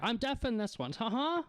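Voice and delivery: put-on high-pitched, deaf old man; high, befuddled old shopkeeper; hard-of-hearing old man. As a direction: explain in a high old-fellow voice.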